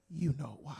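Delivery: very soft, knowing you know why; knowing deadpan. Retold or imitated. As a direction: lean in and say it quietly, all-knowing. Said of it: "I just look at them and I go".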